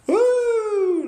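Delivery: high woo; excited whoop. Real, not acted. Host whooping as he shows the cabin, high excited woo.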